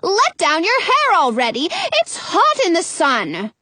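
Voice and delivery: high, impatient complaint; snapping. A narrator's reading. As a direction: snap it impatiently from below. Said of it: "the witch snaps".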